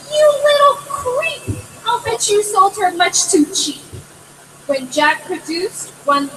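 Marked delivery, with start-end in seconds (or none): 0.1-3.8 high scolding mother. A narrator's reading. Mother scolding her son in a high sharp voice. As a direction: scold him sharply, much higher. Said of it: "The mother said".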